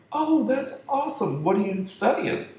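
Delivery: high, bright impressed voice; eager angel. Retold or imitated. Angel gushes at the dancer's college plans in a high voice. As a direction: gush up high with interest. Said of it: "He's like".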